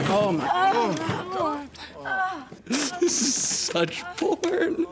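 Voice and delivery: high giggling delight; cracking up at the scene; laughing in disbelief. Real, not acted. Watching the shoot, laughing.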